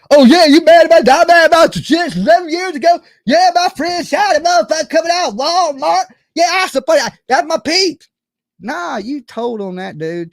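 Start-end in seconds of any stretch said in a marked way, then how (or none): 0.1-8.1 loud, brash imitation of the rival, brushing off a shooting; mock her loudly, like she's bragging. Vlogger mocking a rival's boast about her friend, loud brash imitation. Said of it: "She was just like".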